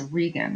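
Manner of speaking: Regan with a long ee, REE-gun; correct Regan pronunciation. Shown as a demonstration. Teacher correcting a character's name for students, Regan said REE-gun. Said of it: "not Reagan".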